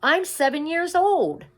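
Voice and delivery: high, very loud desperate shout. A narrator's reading. Mig shouting after the far-off royals, very loud. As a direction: shout after them as they ride away. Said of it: "shouted Mig".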